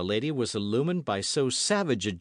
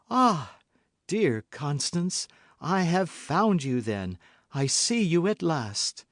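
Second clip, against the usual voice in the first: feigned tenderness; 6 semitones higher than usual. A narrator's reading. Milady feigns tender joy.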